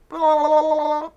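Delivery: high, loud country gibberish babble; mock Southern accent. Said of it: "That's what it sounds like".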